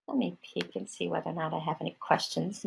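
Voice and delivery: soft aside while checking; softly to oneself while looking; talking to oneself. Real, not acted. Checking for questions, aside to self.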